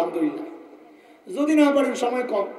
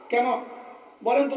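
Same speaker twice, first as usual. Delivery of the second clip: ordinary talk, nothing marked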